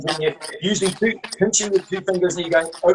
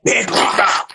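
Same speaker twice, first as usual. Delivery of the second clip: mad storyteller voice. Pretend. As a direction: snap into a mad voice. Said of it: "and then you go mad".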